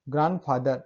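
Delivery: ग्रैंडफादर with no first d, "gran-father"; silent d. Shown as a demonstration. Teacher modelling the word for students, silent d in ग्रैंडफादर. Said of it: "ग्रैंडफादर नहीं".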